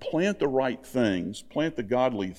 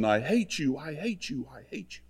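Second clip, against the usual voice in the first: imitated echo; 3 semitones higher, 6 dB quieter than usual. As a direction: mimic an echo dying away. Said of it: "came back from the echo of the mountain".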